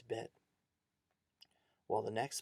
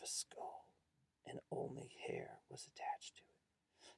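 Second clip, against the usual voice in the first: soft, near-whispered creepy reveal; hushed horror; 6 dB quieter than usual. A narrator's reading. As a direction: whisper the gruesome details slowly. Scary reveal in a ghost story.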